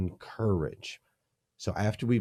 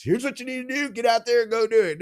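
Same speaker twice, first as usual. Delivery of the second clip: mocking a barking boss, much higher and louder; bossy manager; 5 dB louder, faster than usual. Guest mocking managers who skip to advice, hammed-up bossy order. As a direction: parody a pushy manager shoving orders.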